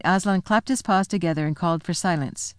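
ordinary delivery

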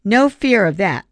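wounded, scornful dismissal; angry and hurt. A narrator's reading. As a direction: shut him down, stung. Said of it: "clearly both angry and hurt".

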